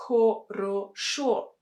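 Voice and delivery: "хорошо" with every O fully sounded, ho-ro-SHO; unnatural full O. Shown as a demonstration. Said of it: "We don't say".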